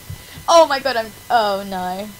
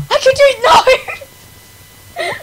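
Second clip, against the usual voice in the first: anguished yell; 12 semitones higher, 7 dB louder, much slower than usual. Real, not acted. Falls during jump.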